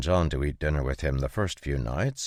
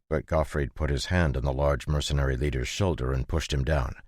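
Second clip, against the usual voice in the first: ordinary delivery, nothing marked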